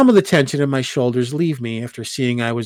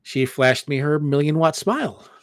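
ordinary delivery, nothing marked

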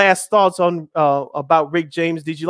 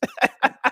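high, short laugh; amused laugh; 7 semitones higher than usual. Real, not acted. Host amused by the album he pulled out, laughing. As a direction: burst into a quick laugh.

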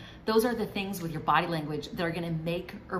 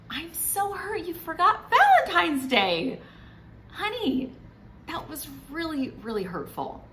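hurt wife; 9 semitones higher, 3 dB louder than usual. Retold or imitated. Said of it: "That's way different than".

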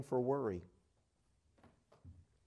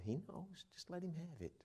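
tender reassurance; 6 semitones higher, 15 dB quieter than usual. Real, not acted. Preacher comforts worried listeners.

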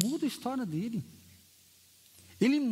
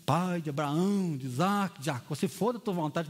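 mock formal prayer; 6 semitones lower than usual. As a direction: parody a stiff church prayer. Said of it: "Presbiteriano já tem oração, mas calma". (imitando uma oração formal e lenta)

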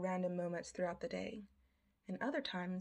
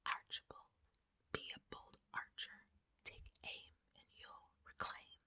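breathy, eerie dream whisper; eerie ASMR whisper; 12 dB quieter than usual. Acted. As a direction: whisper it like a haunting voice. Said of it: "ASMR tone whisper rhyming clauses".

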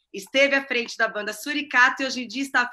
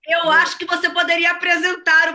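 teasing shout; 5 semitones higher, 6 dB louder than usual. Real, not acted. Teasing guest's radio greetings.

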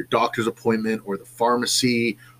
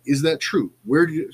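ordinary talk, nothing marked

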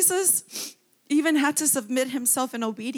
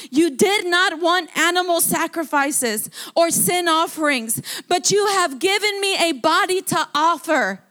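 raised and higher, proclaiming; fervent scripture reading; 4 semitones higher than usual. Acted. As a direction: proclaim Christ's words to God with full force. First fervent reading before tears.

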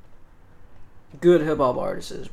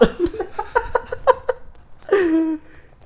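high laughter. Real, not acted. Laughing at British bit.

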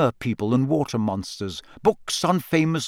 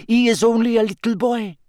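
character voice; 9 semitones higher than usual. A narrator's reading. Character voice in audiobook.

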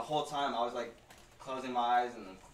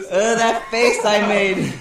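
laughing; 8 semitones higher, 13 dB louder, much slower than usual. Real, not acted. Cringing at own video.

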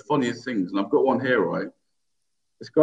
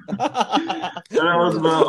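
laughing; 5 semitones higher, much slower than usual. Real, not acted. Laughing at own story.

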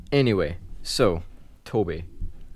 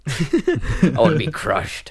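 laughing; 7 semitones higher, 7 dB louder, much slower than usual. Real, not acted. Joking, laughing slow.

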